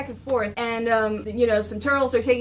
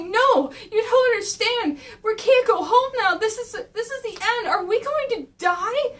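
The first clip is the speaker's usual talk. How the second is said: panicked Mikey; 9 semitones higher than usual. Retold or imitated. Mikey asking his brother for answers, high panicked voice. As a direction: plead up high, terrified. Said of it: "Mikey's going like".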